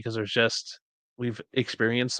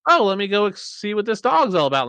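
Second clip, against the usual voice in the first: curious kitten; 9 semitones higher, 5 dB louder than usual. Retold or imitated. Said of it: "and just was like".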